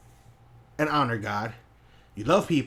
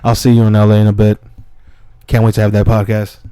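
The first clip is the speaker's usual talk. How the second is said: loud; 2 semitones lower, 13 dB louder than usual. Real, not acted. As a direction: call it out loud, half laughing. Loud shout-outs, laughing at end.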